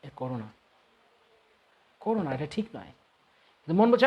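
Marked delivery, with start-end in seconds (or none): 0.0-2.9 soft, low inner warning; hushed, restrained voice of reason; intellect from behind. Acted. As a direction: murmur it from the back of the mind. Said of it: "বুদ্ধি কিন্তু পেছন থেকে বলছে".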